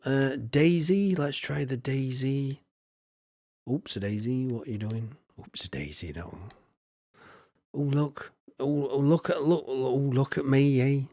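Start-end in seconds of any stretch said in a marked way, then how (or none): 5.4-6.5 much lower and softer; low quiet repeat. Acted. Farmer repeating the hat name to himself, low and soft. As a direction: drop it low and under your breath.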